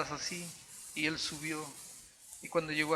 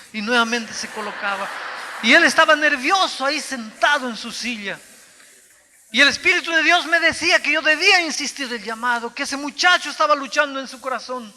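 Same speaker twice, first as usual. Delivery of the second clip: rising to a full-force, much louder preaching cry; fervent preaching; 9 semitones higher, 14 dB louder than usual. Acted. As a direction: preach it out with mounting fire. (predicación ferviente, cada vez más fuerte)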